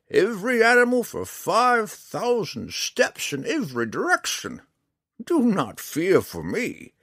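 high, slow, emphatic character voice; proud old ranger. Acted. Old ranger reassuring a drow about his battles, proud. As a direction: boast of old battles with high, swooping stresses.

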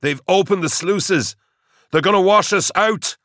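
alarmed, high-pitched call; urgent alarm. A narrator's reading. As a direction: shout the warning as disaster arrives. Officer warns of coming flood.

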